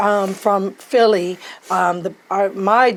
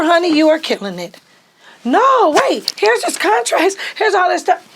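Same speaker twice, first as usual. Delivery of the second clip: high, loud urgent protest; raised, pleading courtroom objection; pleading in court; 10 semitones higher, 5 dB louder than usual. Retold or imitated. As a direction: push back fast and desperate. Arguing in court.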